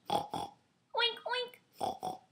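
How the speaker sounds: high playful oink; mock pig oink. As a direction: squeal an oink for the baby. Mother mimics pig for toddler.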